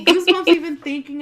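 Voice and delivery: laughing nervously through the words. Real, not acted. Telling her friend about the creepy voice, laughing nervously. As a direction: giggle it out, spooked but amused. Giggling over spooky memory.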